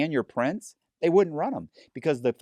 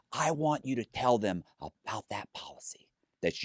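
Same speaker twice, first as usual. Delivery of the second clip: conspiratorial whisper; 5 dB quieter than usual. Retold or imitated. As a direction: drop to a sly whisper. Sly aside in retold demand.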